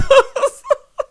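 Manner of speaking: loud burst of high laughter. Real, not acted. Vlogger laughing at [name]'s flashbacks, loud laugh. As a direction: cackle out loud.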